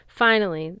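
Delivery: usual speaking voice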